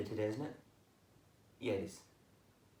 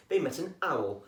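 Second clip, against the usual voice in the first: ordinary delivery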